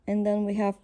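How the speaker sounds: ordinary delivery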